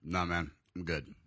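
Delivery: low, slow, soft brush-off; disgusted refusal. Retold or imitated. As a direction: imitate a friend's disgusted, muted refusal. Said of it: "look of just disgust and goes".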